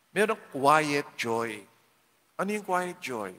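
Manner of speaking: normal talking voice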